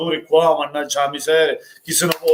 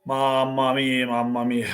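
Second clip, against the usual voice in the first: weary despair; 6 semitones lower, 6 dB quieter than usual. Real, not acted. Fan sighing in despair.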